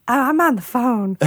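flapper voice. Retold or imitated. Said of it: "in her voice".

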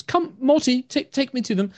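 high, soft, hesitant elf calling out; soft-voiced elf woman. Blind wood elf calls out about smashing, soft high voice. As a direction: call out gently, unsure what broke. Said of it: "she kind of is".

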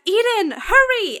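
high, urgent call; shouting back. Acted. Player shouting back to a lagging companion, urgent high shout. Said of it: "I'm shouting back".

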